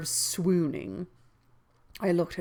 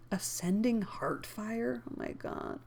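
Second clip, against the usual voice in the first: muttered disbelief, trailing to a whisper; incredulous aside; 4 semitones higher, 7 dB quieter than usual. Real, not acted. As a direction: drop out of narration, baffled. Reader's aside at the wording.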